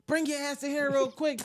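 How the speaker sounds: high, sharp summons; stern call. Shown as a demonstration. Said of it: "kid interpret it like that".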